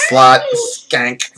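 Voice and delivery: mock Valley Girl insults through laughter. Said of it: "I was doing that voice".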